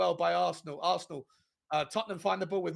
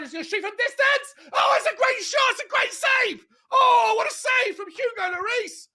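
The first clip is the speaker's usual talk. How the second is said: high, loud, frantic shout at the big save; screaming commentary; 13 semitones higher, 9 dB louder than usual. Real, not acted. Fan watching the derby, screaming at a great save. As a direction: yell it like your team's keeper just saved you. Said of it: "I'm screaming and shouting".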